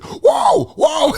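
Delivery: high startled yell; mock alarmed shout. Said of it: "he's like".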